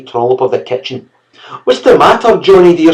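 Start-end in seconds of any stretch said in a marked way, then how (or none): 1.6-2.9 high, loud anxious mother; mother crying out. A narrator's reading. Mother crying out to her hurt son. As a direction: rush in to shield her boy. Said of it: "she cried".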